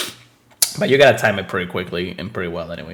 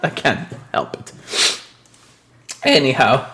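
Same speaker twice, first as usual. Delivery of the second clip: laughing; 3 semitones higher, slower than usual. Real, not acted. Sorry for all the sneezing.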